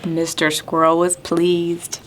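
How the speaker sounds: sing-song rhyme with gasping wind effects; counting rhyme for kids. Acted. Teacher reading a counting rhyme to children, sing-song. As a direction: read it like a children's counting game.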